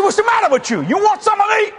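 high-pitched mock fighting challenge; retold anger. Preacher squaring up to backing-away critics, angry. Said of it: "I got angry".

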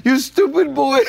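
a laughing imitation of his grandfather yelling at him; mock grandfather yell. Telling his cohost how grandpa yelled, laughing mock scold. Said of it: "he was going".

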